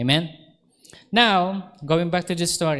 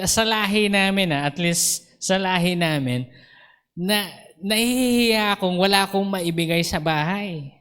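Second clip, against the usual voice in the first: ordinary talk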